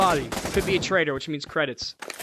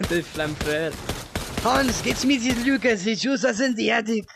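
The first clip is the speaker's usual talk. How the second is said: higher, louder mock German officer; hammed-up German voice; 7 semitones higher, 4 dB louder than usual. Mock German, calling to Hans for the gear. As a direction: bark it like a cartoon German commander.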